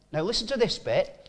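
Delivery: ordinary talk, nothing marked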